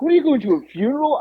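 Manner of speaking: high-pitched incredulous question; retold ribbing, voice jumping up; mocking disbelief. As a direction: tease them with a baffled question. Mocking the friend's funeral-like outfit.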